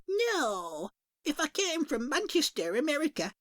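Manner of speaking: high, sing-song dearie voice; a chirpy shopkeeper greeting at the bell. Acted. Shopkeeper greets a customer, high and sing-song.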